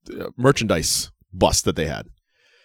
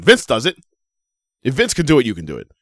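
ordinary delivery

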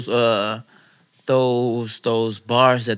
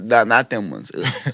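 ordinary talk

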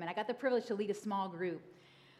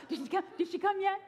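put-on excited child; 9 semitones higher than usual. Child checking under pillow.

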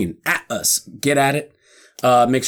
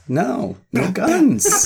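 high-pitched scold; 10 semitones higher, much slower than usual. Acted.

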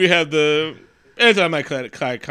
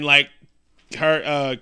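ordinary talk, nothing marked

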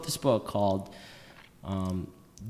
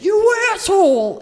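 yelled high and loud; angry shout; 12 dB louder than usual. Retold or imitated. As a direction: scream it at a passing driver. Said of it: "rolled down his windows and said".

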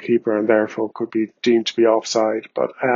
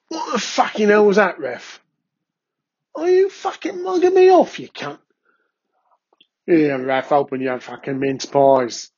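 Mark Bertram ranting at the ref; 3 semitones higher than usual. Pretend. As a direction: scream abuse at the ref in a put-on voice. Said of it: "So now over to Mark Bertram".

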